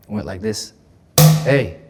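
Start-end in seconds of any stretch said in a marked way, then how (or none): 1.4-1.8 sharp, louder "Hey."; a short, firm "Hey."; sharp call. Retold or imitated. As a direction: snap "Hey." to get attention. Mom demanding attention.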